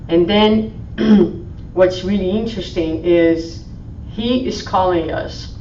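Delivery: normal talking voice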